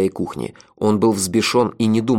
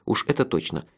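ordinary delivery

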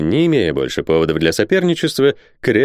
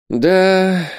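a drawn-out, higher-pitched sighing yes; sighed reply; 10 semitones higher than usual. A narrator's reading. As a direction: say it as a sigh. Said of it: "вздохнул капитан Сервадак". (со вздохом)

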